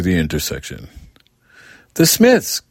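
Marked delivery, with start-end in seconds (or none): 1.9-2.6 put-on high, loud child's cry of delight; excited shout. A narrator's reading. Girl shouting to her mother on the street corner, excited. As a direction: shout it out, thrilled to see them. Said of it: "Kathy shouted".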